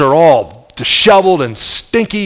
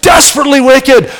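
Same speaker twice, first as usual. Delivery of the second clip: much higher, loud preaching shout; shouted answer; 10 semitones higher, 4 dB louder than usual. Real, not acted. Preacher answering his own question, shouting. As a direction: cry it out to the congregation.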